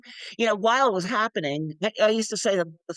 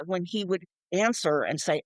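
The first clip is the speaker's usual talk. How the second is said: ordinary delivery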